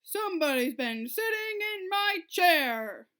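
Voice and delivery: papa bear bellowing at his pushed-about chair. A narrator's reading. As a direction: bellow it like an angry big bear. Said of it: "he bellowed in his great big voice".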